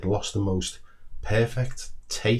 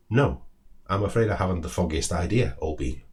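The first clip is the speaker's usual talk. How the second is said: plain reading voice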